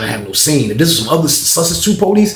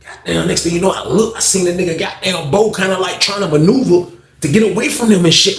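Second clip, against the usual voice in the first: ordinary talk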